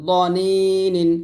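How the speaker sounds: দনিনিন very slow with a thick দ; heavy dad, slow. Shown as a demonstration. Teacher demonstrating heavy দ in দনিনিন. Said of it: "এরকমভাবে মোটা করবেন".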